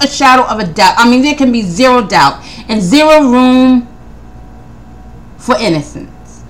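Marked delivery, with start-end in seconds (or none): 1.6-6.4 slipping into a country accent. Real, not acted. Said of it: "I don't know where that country accent came from".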